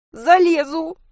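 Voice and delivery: high, loud tearful wail; sobbing cry. A narrator's reading. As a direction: wail it out desperately. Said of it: "рыдала Анька". (отчаянно, сквозь рыдания)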